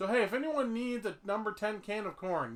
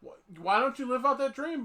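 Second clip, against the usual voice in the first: ordinary talk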